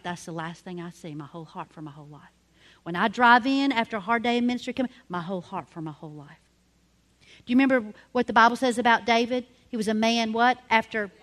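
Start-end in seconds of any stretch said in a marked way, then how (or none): 0.0-2.2 very soft, low, heartfelt pledge; hushed reverence. Real, not acted. Reverently recalling her personal pledge. 5.1-6.4 low, soft personal vow; hushed reverence. Real, not acted. Repeats pledge after a hard day.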